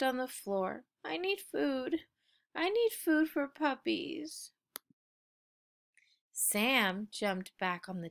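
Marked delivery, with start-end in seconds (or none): 1.0-4.5 sad puppy. A narrator's reading. Puppy sulking on floor.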